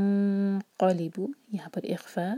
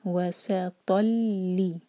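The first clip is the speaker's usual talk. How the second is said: very slow drawn-out वसतल; slow recitation; much slower than usual. Shown as a demonstration. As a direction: stretch वसतल slowly. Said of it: "धीरे धीरे पढ़ें".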